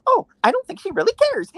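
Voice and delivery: high, fast, airy voice for the friend; imitating friend. Retelling what the friend said in the elevator, high and breezy. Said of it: "the friend of who I met there goes".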